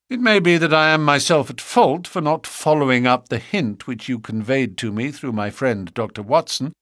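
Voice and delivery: ordinary delivery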